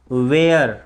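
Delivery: वेयर as ve-ar, r sounded; common mispronunciation. Shown as a demonstration. Teacher imitating the common reading, वेयर with r pronounced. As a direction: say वेयर like wear with r. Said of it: "बहुत सारे लोग वेयर ही पढ़ते हैं".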